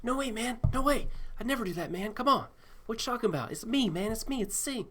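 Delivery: squeaky weaselly protest of innocence; whiny Singh. Retold or imitated. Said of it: "And Singh's like".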